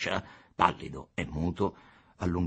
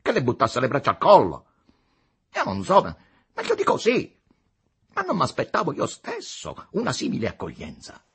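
louder, high-pitched sneering retort; arrogant, derisive; 9 semitones higher, 8 dB louder than usual. A narrator's reading. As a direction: ridicule his expectations, arrogantly. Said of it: "con tono tra arrogante e derisorio". (replica arrogante e derisoria)

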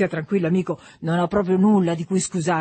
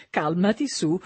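usual speaking voice

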